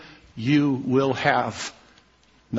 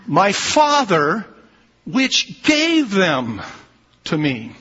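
slow emphatic reading; 5 semitones higher, 3 dB louder, much slower than usual. Acted. Slow emphatic reading of key verse.

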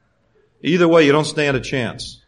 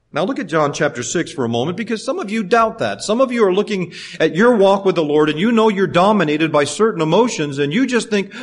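ordinary delivery

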